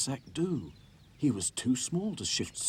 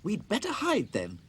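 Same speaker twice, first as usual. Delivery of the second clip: higher, slower, urging; urgent doll voice; 11 semitones higher, 4 dB louder than usual. A narrator's reading. Dotty urging the dolls to hide, higher. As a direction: hurry the others away. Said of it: "said Dotty".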